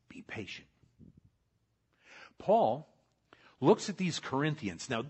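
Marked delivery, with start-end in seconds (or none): 0.1-0.7 unvoiced, gentle repeat; soft whisper. Pastor echoing God's promise in a whisper. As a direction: whisper it again, even softer.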